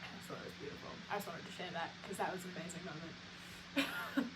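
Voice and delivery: real soft whisper of admiration. Real, not acted. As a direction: murmur it fondly to yourself. Wistful afterglow of the story.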